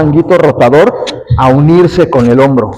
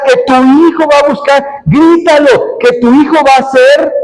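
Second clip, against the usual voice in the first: impassioned preaching; 9 semitones higher than usual. Acted. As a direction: cry each command out to the congregation, stretching the key words. (predicación exaltada, en tono alto y alargado)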